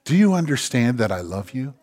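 soft, low, voiced hush; tender near-whisper. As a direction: imitate God whispering love, gently. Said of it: "he whispers and he says".

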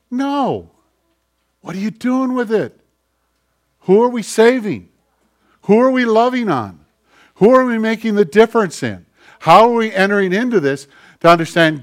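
high, loud, driving questions; fiery preaching. Acted. Pastor challenging the church, full-force preaching. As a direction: hammer each question home to the congregation.

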